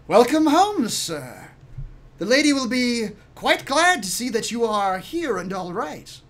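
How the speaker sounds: high, projected formal greeting; lilting, high-pitched gentleman's voice; gentleman servant. Acted. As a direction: welcome the young master home in a high servant's voice. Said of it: "gentleman, um, approaches and says".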